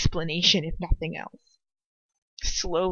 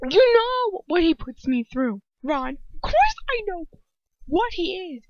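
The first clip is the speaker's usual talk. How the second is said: raised, high-pitched insistence; urgent; 10 semitones higher, 5 dB louder than usual. A narrator's reading. Hermione insisting to Ron that she knows, urgent. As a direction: insist urgently, close to desperate. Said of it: "I say urgently".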